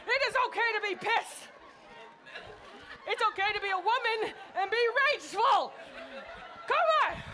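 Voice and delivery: high-pitched, hammed-up exhortation; rousing rage. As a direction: urge the crowd to let anger out, pitched high. Urging crowd to vent rage.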